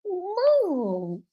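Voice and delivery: high, drawn-out mock protest; imitating embarrassed son. Grown son being babied by his mother, high drawn-out protest. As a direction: stretch it into a childlike complaint. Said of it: "he was like".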